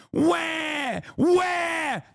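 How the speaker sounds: high, drawn-out fake bawling; mock crybaby wail. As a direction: wail "wah" like a big baby. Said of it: "That's what you sound like".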